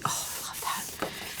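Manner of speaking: breathy, drawn-out whisper of delight; hushed delight. Real, not acted. Vlogger swooning over a wallpaper sample, hushed delight.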